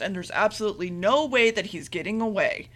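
normal talking voice

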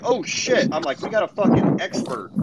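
sarcastic fake awe at an expert; mock amazement. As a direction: pretend to be blown away. Sarcastic reaction to her credentials.

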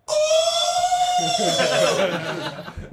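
high whooping laugh; loud laughing shriek. Real, not acted. Big laugh at the name.

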